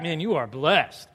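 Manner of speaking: Jesus warmly praising Peter; tender blessing. Acted. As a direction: bless him gently. Jesus answering Peter, acted.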